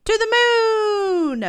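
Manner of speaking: high, loud, drawn-out cheer; big slow playful whoop; excited cry. Pretend. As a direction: call it out like a launch, stretching the words. Excited answer to child's guess.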